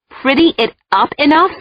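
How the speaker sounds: high, indignant sputter; a wife outraged at a clumsy proposal, sputtering. A narrator's reading. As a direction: spit it back in disbelief. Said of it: "I sputtered".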